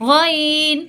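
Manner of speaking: গইন gargled from the top of the throat; গইন rough from the throat's end; Arabic ghain. Shown as a demonstration. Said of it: "গলার ঠিক শেষ অংশ থেকে".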